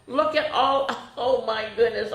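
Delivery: plain reading voice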